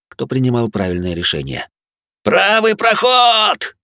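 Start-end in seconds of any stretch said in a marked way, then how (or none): 2.2-3.8 long desperate warning scream; frantic shout. A narrator's reading. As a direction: yell it high in panic. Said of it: "раздался истошный крик с улицы".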